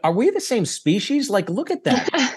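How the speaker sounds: high, incredulous Pittsburgh locals gawking; amazed locals. Retold or imitated. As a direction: play the stunned locals, voice climbing in disbelief.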